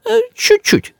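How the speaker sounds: very high, tiny placating voice; backpedalling. A narrator's reading. Grandmother softens her criticism, backpedalling. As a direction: make "чуть-чуть" sound tiny, high. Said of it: "даёт бабушка задний ход".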